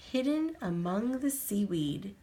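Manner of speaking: plain reading voice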